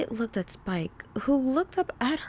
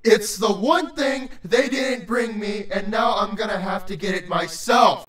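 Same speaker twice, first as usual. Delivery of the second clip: low, impatient creature voice; deep-voiced, hungry grump; stern, bloated creature; 3 semitones lower, 4 dB louder than usual. A narrator's reading. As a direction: demand it irritably in a deep voice. Irritated creature in story.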